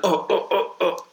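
high urgent oh oh oh, squirming; the pee dance, a desperate rising whimper. Retold or imitated. Kid at the urinal can't wait, high urgent whimpers.

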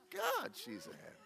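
high, exasperated cry of disbelief; mock exasperated high exclamation. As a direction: throw up your hands at how difficult she is. Exasperated retold remark to Lisa.

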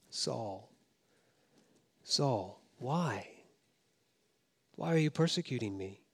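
soft, low, gentle plea; tender call. Acted. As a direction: call him by name softly, with love. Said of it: "The voice instead calls him by name".

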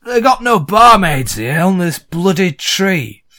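broad northern barmaid voice; gruff Yorkshire complaint; Yorkshire accent. Acted. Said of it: "she sees you and goes".

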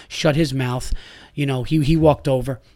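ordinary talk

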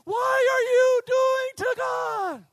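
high, loud, drawn-out storm shout; shouting over wind. Acted. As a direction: holler each word slowly through the gale. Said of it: "You have to shout".

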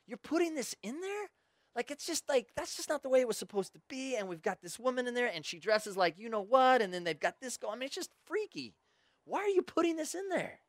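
high, disbelieving complaint; incredulous Timothy. Retold or imitated. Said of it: "Tim's, like, looking at Matthew going".

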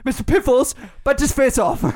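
hammed-up British order to an attack pet; bad accent. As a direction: do a mock villain in a poor British accent. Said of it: "You're so bad at accents".

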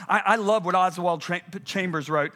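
usual speaking voice

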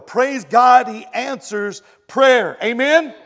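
loud, high preacher's praise; preaching at full force. Acted. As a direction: lift the crowd with a loud amen. Preaching burst.

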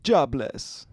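punchline in a bad Jamaican accent; hammy Jamaican accent. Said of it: "a shitty Jamaican accent".

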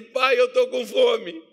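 put-on high, loud, drawn-out child's plea; filho pedindo comida. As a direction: imitate a kid pleading, stretched out. Son's plea to father, acted. (filho manhoso, agudo e arrastado)

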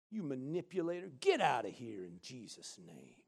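low, soft, slowing command; hushed rebuke. Acted. Child of God rebuking the manipulator, hushed and low. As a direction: order him out under your breath.

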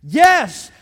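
high, very loud affirmation; booming, jubilant-sized yes, pause between; emphatic shouted yes. Acted. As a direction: shout each yes as a resounding answer. Voices the Father's emphatic answer.

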